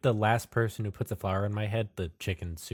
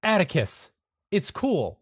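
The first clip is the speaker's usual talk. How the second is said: high, loud urgent reassurance; calm her fast and firmly; 10 semitones higher, 7 dB louder than usual. Acted. Anderson calming Atticus with the knife, urgent reassurance.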